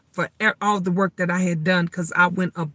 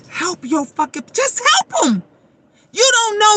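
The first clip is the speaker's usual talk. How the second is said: high pleading cry; 11 semitones higher than usual. Real, not acted. Urging viewers to help their people, high pleading cry. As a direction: beg them to just help.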